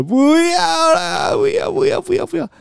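very high, slow wailing no; imitating toddler refusing. 模仿孩子哭着抗拒. (高声拖长模仿小孩哭闹)

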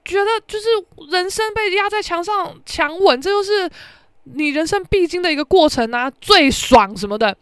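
high, gushing encouragement; giddy egging-on; excited cheering. Retold or imitated. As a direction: hype the girls up, breathless. She cheers her roommates on.

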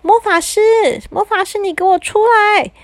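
high desperate yell for the wizard; calling out. A narrator's reading. Boy stuck as a giant yells for the wizard, high panicked call. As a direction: shout high for help, panicked. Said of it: "他忍不住大叫".

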